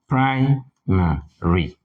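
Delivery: Primary, very slow, weight on PRI; stress on first syllable. Shown as a demonstration. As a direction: stretch out Primary, punching PRI. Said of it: "with the stress on the first syllable".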